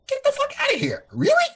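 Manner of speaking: squeaky high disbelief, almost a cry; incredulous squeal. Real, not acted. Scoffing at kids who want to be YouTubers, incredulous squeal. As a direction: shriek your disbelief.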